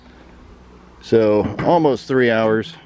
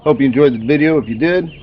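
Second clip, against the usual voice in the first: YouTube outro; 3 semitones higher than usual. Acted. Upbeat video outro.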